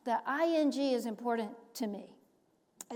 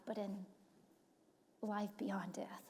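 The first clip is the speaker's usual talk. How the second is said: whisper; 3 semitones lower, 10 dB quieter than usual. Acted. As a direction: let it fall away into a whisper. Preacher hushing on afterlife.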